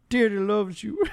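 mock dad shout. Teasing the host about dad movies, mock dad shout. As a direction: call out like a proud dad.

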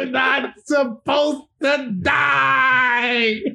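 slow, high crying wail; a drawn-out fake scream; mock dying wail. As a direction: drag out a hammed-up death cry. Repeats the dummy's death cry.

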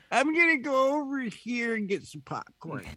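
high mock voice of the guest; airy high quote of him walking off; mock guest brush-off. Guest brushing off the host, in a high mock voice.

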